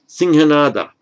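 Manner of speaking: Singhanada said high and loud, syllable by syllable; careful Sanskrit pronunciation. Shown as a demonstration. Teacher models Singhanada for Sanskrit students, high and loud. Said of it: "is the lion's roar".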